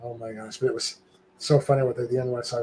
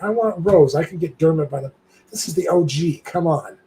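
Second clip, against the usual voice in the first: higher-pitched eager plea; retold excited demand, voice lifted; excited insistence; 5 semitones higher, 4 dB louder than usual. Choosing a photo with a favorite star, excited insistence.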